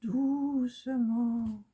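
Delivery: lent et aigu, en étirant chaque syllabe; tout doucement. Shown as a demonstration. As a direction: chantonner doucement comme pour s'encourager. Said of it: "J'y vais doucement". (voix lente et haute qui étire « doucement »)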